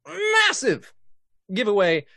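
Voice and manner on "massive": "massive" shot way up high and loud; high, loud showman's "massive"; hyped emphasis. Real, not acted. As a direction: blow up the word "massive". Hyping up a big giveaway.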